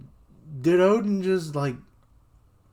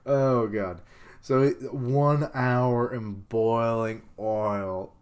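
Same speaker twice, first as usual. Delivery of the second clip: ordinary delivery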